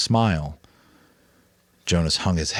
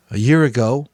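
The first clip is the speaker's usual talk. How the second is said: higher, louder youthful worry; Jonas, anxious boy; 6 semitones higher, 8 dB louder than usual. A narrator's reading. Jonas telling the Giver it is no use, anxious. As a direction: protest that it is hopeless.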